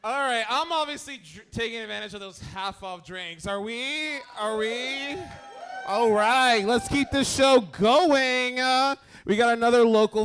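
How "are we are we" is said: ordinary talk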